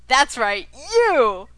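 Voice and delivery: booming hyped-up announcer. Acted. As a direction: call it out like a fight announcer. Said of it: "enough with the, uh, annoying announcer voice".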